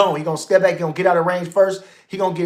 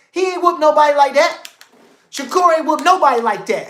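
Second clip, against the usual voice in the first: hyped up; 6 semitones higher, 3 dB louder than usual. Real, not acted. Hyped praise of fighter.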